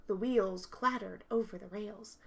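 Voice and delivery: usual speaking voice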